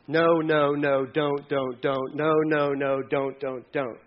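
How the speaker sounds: low, monotonous string of scolding; droning prohibitions. Acted. Parents reeling off rules to a child, droning. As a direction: repeat it evenly like endless rules. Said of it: "obedience to your parents".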